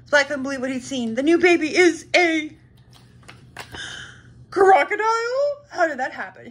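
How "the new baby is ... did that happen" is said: shocked. A narrator's reading. Splat sees the new baby is a crocodile, shocked. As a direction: stretch it out in horror.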